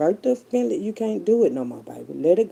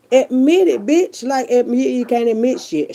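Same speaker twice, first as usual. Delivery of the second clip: yelling; 8 semitones higher, 8 dB louder than usual. Real, not acted. Yelling at family.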